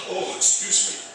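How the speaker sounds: weary breathy sigh then apology; tired sigh. Acted. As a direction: let out a long tired sigh. Tired chief sighs, apologizes.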